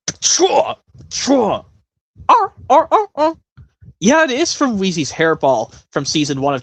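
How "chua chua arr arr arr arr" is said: high, loud barking creature cries; squeaky cartoon pup noises. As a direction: yelp like a little cartoon dragon.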